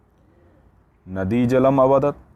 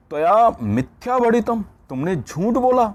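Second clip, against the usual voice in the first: accusing jackal; 12 semitones higher, 3 dB louder than usual. Acted. Jackal accuses tiger of lying.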